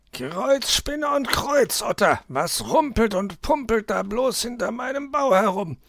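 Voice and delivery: very high put-on fox voice, exclaiming; startled fox. Herr Fuchs wakes to rumbling behind his den, startled high voice. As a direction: squeal it as a roused, puzzled fox.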